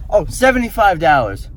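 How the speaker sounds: high, loud acted reaction; acted price shock. Shopper at garage sale given a high price, acted surprise.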